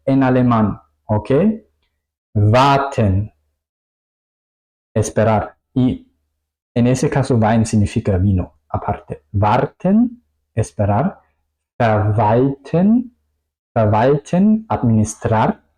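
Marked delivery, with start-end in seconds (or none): 2.3-3.3 "Warten" opened with a voiced v; W alemana como V. Shown as a demonstration. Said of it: "suena como una V en español". 9.3-10.2 "Warten" with a v onset and rising end; W alemana como V. Shown as a demonstration. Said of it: "suena como una V en español". 11.8-14.7 "Verwalten" with f at the start, v for the w; W alemana como V. Shown as a demonstration. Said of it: "suena como una V en español".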